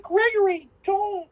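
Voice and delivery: high, hammed-up scolding mother voice; mock fussing woman. Retelling the movie, a hammed-up fussing woman defending Gregory. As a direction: chide him like a fussy older woman.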